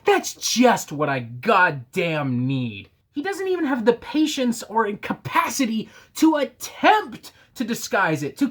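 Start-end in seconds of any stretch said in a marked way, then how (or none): 0.0-2.9 sarcastic. Real, not acted. Sarcastic complaint.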